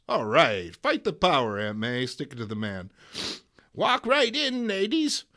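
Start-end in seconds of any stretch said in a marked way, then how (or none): 0.1-2.0 louder rallying cheer; cheering aside. Real, not acted. Host cheering on Aunt May, loud rallying aside. 3.7-5.2 much higher, louder proud granny; triumphant old lady. Acted. Aunt May holding the door for others, high triumphant.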